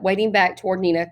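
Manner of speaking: usual speaking voice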